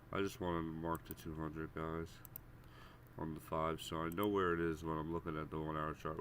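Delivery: normal talking voice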